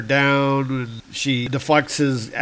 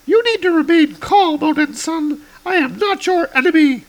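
much higher heroic woman; high woman's voice; 6 dB louder than usual. Acted. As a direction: lift the voice high for Girl Thor. Girl Thor voiced.

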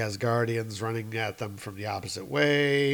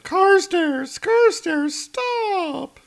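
Maureen shrieking; 6 dB louder, much slower than usual. Acted. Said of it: "Maureen is like".

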